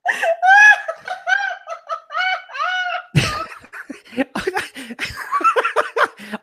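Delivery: long, high shrieking laugh that cracks toward a cry; helpless laughter. Real, not acted. To friends on a video call, a long shrieking laugh. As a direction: laugh until you can barely breathe.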